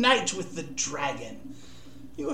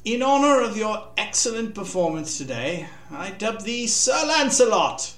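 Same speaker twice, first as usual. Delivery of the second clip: booming royal proclamation, higher and louder; solemn regal knighting; 7 semitones higher, 4 dB louder than usual. A narrator's reading. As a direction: proclaim it to the whole crowd. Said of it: "he cried".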